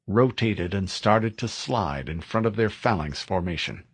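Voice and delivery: normal talking voice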